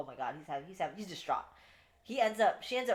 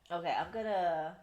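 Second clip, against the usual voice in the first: ordinary talk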